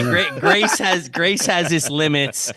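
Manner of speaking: slow, loud, drawn-out pronouncement; mock solemn decree. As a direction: lay down the law slowly. Mock pronouncement on rule-forgetters.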